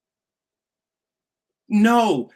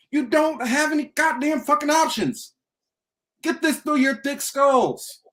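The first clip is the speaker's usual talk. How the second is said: higher, heated rant, slowing on the last line; angry scolding; 6 semitones higher than usual. Real, not acted. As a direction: drive it home like a furious lecture. Ranting at listeners.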